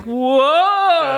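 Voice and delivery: loud, high amazed shout; amazed whoa. Pretend. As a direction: cry out in wonder. Founding fathers struck by lightning.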